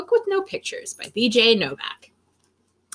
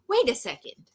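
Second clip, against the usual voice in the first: usual speaking voice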